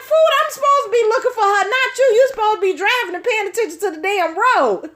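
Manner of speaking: exasperated scolding. Retold or imitated. Scolding the friend for not watching the road, high and fast. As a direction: lecture her, fast and fed up.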